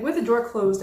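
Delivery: ordinary talk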